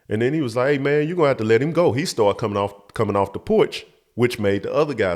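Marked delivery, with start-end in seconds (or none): 0.7-1.9 quick, higher-pitched imitated warning; fast retold confrontation; retold demand. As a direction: act out a man stepping in, hurried. Retold demand to release the boy.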